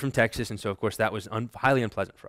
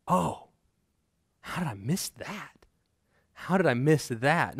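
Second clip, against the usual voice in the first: stunned realization; 2 semitones higher than usual. Retold or imitated. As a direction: repeat it slower as it sinks in. Retold sudden realization, bomb in soul.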